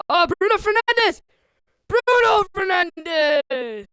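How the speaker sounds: loud, high cry with the name stretched; drawn-out near-goal call; excited commentator shout. Real, not acted. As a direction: shout the player's name as he shoots. Commentator shouts a player's shot.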